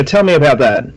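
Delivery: normal talking voice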